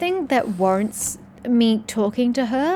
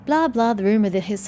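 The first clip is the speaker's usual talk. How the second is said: usual speaking voice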